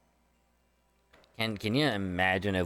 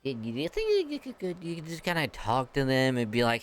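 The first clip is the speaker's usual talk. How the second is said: high, meek begging voice; timid plea; 3 semitones higher than usual. Pretend. Player asking Vidofnir to talk down the dragons, meek and high. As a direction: ask like a nervous kid.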